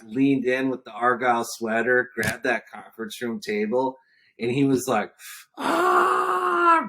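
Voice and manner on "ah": loud high roaring shout; roared back. Retold or imitated. Client roaring back across the conference table, loud high shout. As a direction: roar it back like a lion. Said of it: "roared back at me".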